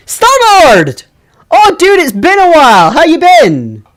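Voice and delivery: high, loud, excited game-character hail; character greeting. Acted. Game character greets Star-Lord, high and loud. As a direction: call out to an old friend, delighted.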